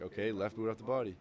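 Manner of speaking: mock drill instructor command; drill instructor order. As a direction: call it out like a DI to recruits.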